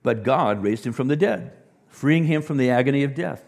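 loud and high, triumphant; preacher crying out the resurrection; booming proclamation. Acted. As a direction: ring it out to the back row. Climax of scripture reading.

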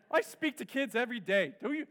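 mock outrage at the camp staff, loud and indignant; indignant outburst. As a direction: puff up like a slighted celebrity. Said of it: "wanted to go to the Young Life staff and say".

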